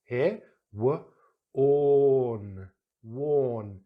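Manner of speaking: worn with a rounded or; model worn. Shown as a demonstration. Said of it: "that or sound".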